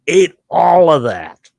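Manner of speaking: high, loud and drawn out; loud amazement. Real, not acted. Storyteller amazed at his friend's huge meal, loudly emphatic. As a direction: stretch each word in loud astonishment.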